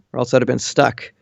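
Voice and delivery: ordinary talk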